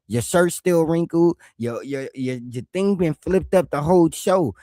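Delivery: normal talking voice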